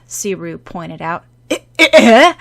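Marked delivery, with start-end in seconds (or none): 1.5-2.3 flustered. Acted. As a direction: caught out, high and loud stammering. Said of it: "Shout-on flushed".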